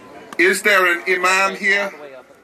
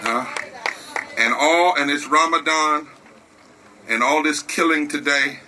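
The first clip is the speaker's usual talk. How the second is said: lower, hushed preacher voice; solemn preaching; 5 semitones lower than usual. Real, not acted. Preacher leads moment of prayer.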